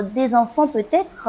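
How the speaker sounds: ordinary delivery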